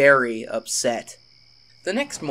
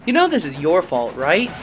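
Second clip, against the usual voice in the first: a touch louder accusing voice; scowling; 4 semitones higher, 7 dB louder than usual. A narrator's reading. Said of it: "Percy scowled".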